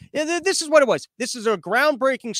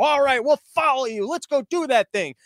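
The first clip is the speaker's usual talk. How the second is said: higher-pitched gullible follower voice; eager loser; 7 semitones higher than usual. Retold or imitated. Losers agreeing to follow the agents, eager loser. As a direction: play the dopey sidekick, keen to tag along. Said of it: "the fucking losers go".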